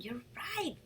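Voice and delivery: usual speaking voice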